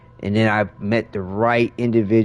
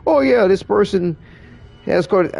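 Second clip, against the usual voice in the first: higher, rising mock-alarm doctor voice; hammed-up worried medic, climbing higher; 8 semitones higher than usual. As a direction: play a panicked doctor ordering hospital. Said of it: "and I was just like".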